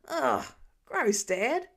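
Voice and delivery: squirm out of the hug; disgusted protest. A narrator's reading. Son pushing off dad's smelly hug, loud disgust.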